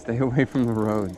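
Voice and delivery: loud deep warning shout. Real, not acted. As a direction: call it out loud and low. Calls out a warning.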